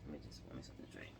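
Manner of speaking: fast, unvoiced whisper; whispered aside. Real, not acted. Pausing her story for a drink, whispering. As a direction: mutter it off to the side. Aside while getting emotional.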